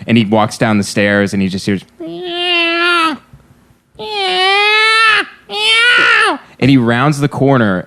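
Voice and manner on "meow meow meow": high shrill cat meowing. Retold or imitated. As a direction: yowl like a cat. Imitating cat sounds in story.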